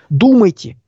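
ordinary talk, nothing marked

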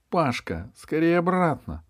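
высокий тревожный оклик; окликни друга, торопя назад; сдержанный крик. A narrator's reading. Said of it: "чуть было не крикнула ему". (сдержанный окрик)

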